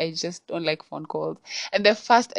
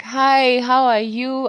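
high, louder sugary greeting; caller lady voice; 7 semitones higher, 5 dB louder, slower than usual. Retold or imitated. Imitating the mystery caller with a high, loud hello. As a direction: put on the sweet voice of the stranger on the phone. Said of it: "is like".

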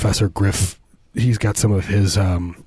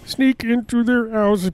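backwards speech; 11 semitones higher than usual. Pretend. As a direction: drag it out like a record played backwards. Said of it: "I really sound like a backwards".

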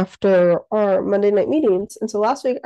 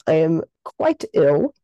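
sick voice; 4 semitones lower, slower than usual. Real, not acted. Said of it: "if you can't hear it in my voice".

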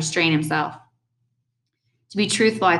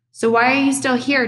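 higher, exasperated demand; angrily; 7 semitones higher, 4 dB louder than usual. A narrator's reading. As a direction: snap at someone who should have left. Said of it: "said to me angrily".

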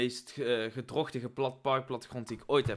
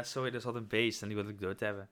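ordinary delivery

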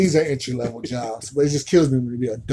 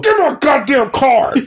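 rude shout; 12 semitones higher, 9 dB louder than usual. Acted. Mock-rude customer shouting.

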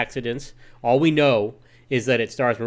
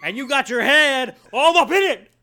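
hammed-up, high-pitched yelling impression; Al Pacino in Heat; 12 semitones higher, 5 dB louder than usual. Co-host quoting a movie outburst for laughs, loud high-pitched impression. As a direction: holler it wildly over the top. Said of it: "my Al Pacino and Heat impersonation".

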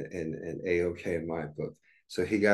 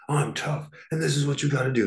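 higher mock tough-guy voice; a light send-up of a tough-guy pose; Andrew Tate parody; 8 semitones higher than usual. Parody sketch of Andrew Tate, higher mock tough guy. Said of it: "S N L doing a parody of Andrew Tate".

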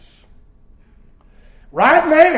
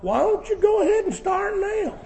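mock retort; 11 semitones higher than usual. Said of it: "And I thought".

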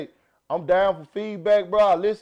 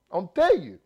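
ordinary talk, nothing marked

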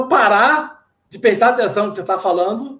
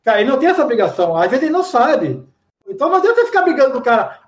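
ordinary delivery

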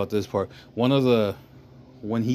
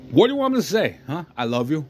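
mock defiance, starting high and swooping; acted scornful challenge that drops to an offhand finish; unapologetic; 6 semitones higher, faster than usual. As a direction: throw it back with no remorse, imitating a cold father. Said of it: "he's so unapologetic about his actions".

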